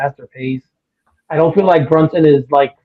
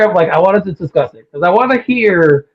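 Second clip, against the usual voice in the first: usual speaking voice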